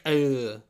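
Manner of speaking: loud pained grunt of a man whose fingers break; mock pained grunt; pained groan. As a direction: groan like your fingers just got snapped. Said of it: "gets his fingers broken and is like".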